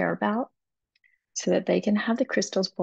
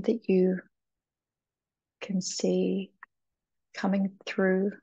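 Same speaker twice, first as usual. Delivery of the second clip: guided meditation. Acted. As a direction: guide the listener gently into calm. Leads a short crystal meditation.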